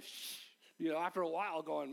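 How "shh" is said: shushing. Retold or imitated. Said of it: "people going".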